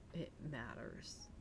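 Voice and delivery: ordinary talk, nothing marked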